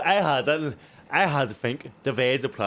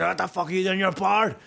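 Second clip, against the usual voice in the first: put-on old man scolding; 6 semitones higher than usual.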